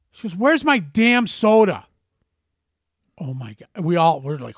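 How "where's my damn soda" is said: loud, slow, higher-pitched mock outburst; hammed-up huffy six-year-old; huffy child's demand. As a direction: imitate a little kid demanding, drawn out and loud. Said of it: "She's getting all huffy and puffy".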